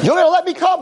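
high, loud surprised question; eager Howie. Retold or imitated. Howie surprised to be invited, high eager voice. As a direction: squeak it out in disbelief.

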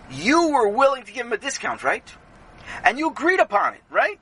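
high, emphatic cross-examination; insistent questioning. Acted. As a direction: press each point for agreement. Rebbe cross-examines Sammy.

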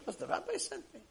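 high, small soft voice; acted meek reply. Patient confirming the rabbi sent him, high and meek.

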